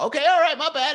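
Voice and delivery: high-pitched defensive concession; mock apology. Person corrected online about an address, mock apology. Acted reply to being corrected.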